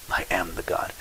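I am the God whispered softly and reverently; a soft awed whisper of I am. Shown as a demonstration. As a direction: hush it, breathe the words I am. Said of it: "Notice the word".

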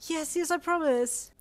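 high, loud, joyful gushing; bubbling. A narrator's reading. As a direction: bubble over with delight, stretching the words. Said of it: "you bubbled".

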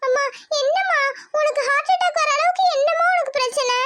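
put-on high child voice begging the doctor; desperate little girl's plea; pleading girl. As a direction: beg him to save her mother.

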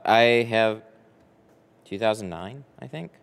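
ordinary talk

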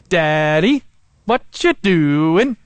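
lightly voiced small child, slow and drawn out; imitating little daughter. Father imitating his daughter asking what he's doing, slow childlike voice. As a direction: mimic a little girl asking sweetly, slowly. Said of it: "I kinda sound like this Swedish chef".

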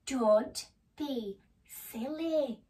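slow, drawn-out chiding; mock scolding. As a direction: stretch each word to tease a silly friend. Nibbles scolds the frightened mouse.

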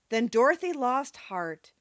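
ordinary delivery, nothing marked

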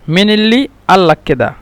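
"মিনিল্লি আল লাক্কেদা" in Egyptian colloquial sounds; Egyptian dialect. Shown as a demonstration. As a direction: hamza in place of q in "আল". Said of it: "কফকে মিশরিরা হামজা বলে".

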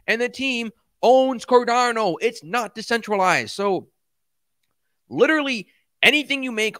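venting real anger, words dragged out; angry rant. Real, not acted. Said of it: "and I got triggered".

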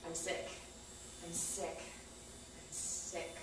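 drained words trailing off to nothing; fading to a whisper. Real, not acted. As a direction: let it die away into a whisper. Numb repeated words fading out.

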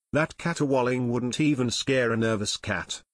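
ordinary delivery

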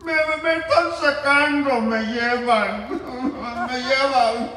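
put-on high, slow, whiny drunk cry; drunk pleading. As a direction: beg like a drunk being dragged off. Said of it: "Chelito, el salvadoreño".

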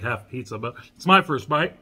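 speaking between bites; talking while chewing. Real, not acted. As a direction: mouth partly full of food. Seen 0: Pizza bite near 4.6s, then talks while visibly chewing.

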